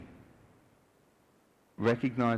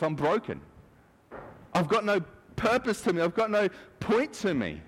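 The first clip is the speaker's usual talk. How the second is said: higher, plaintive moan of worthlessness; self-pitying whine; 8 semitones higher than usual. Retold or imitated. Moses moaning to God that he has no purpose, plaintive. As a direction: whimper that you have no purpose.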